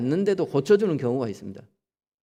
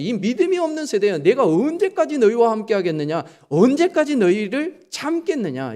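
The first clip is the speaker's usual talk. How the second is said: higher, exasperated rebuke of a teacher; Jesus rebuking; 7 semitones higher than usual. Retold or imitated. Jesus rebukes his disciples, higher exasperated voice. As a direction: scold the faithless disciples. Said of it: "예수님께서 뭐라 그랬어요".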